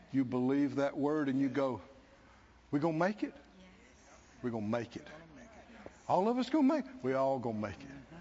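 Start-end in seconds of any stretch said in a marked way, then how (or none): 2.7-8.2 quiet assurance. Acted. Believer assuring self.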